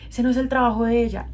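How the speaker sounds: mother scolding the husband; angry mother. Retold or imitated. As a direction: mom snapping at her husband. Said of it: "le dice como".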